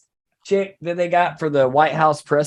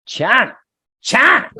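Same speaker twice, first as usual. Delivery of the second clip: "China" said twice, the second far higher and louder; mock Trump China; 9 semitones higher, 5 dB louder than usual. Comic repeat of "China", the second much higher. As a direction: stretch "China" up high the second time.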